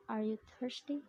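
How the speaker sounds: yes-no question rising on THIRSTY; rising intonation. Shown as a demonstration. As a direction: lift the pitch at the end of THIRSTY. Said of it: "Rising intonation is common in yes-no questions".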